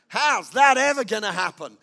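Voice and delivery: loud, scornful disbelief; mocking unbelief, half shouted; doubting scoff. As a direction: scoff as if it can't be done. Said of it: "that was a declaration of unbelief".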